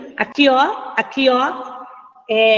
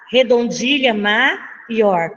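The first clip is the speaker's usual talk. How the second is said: "maior" split slowly as ma... ior; slow, deliberate dictation of the term; emphatic drilling; slower than usual. Shown as a demonstration. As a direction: hold the first beat of "maior", then finish. Teacher repeats term for memorizing.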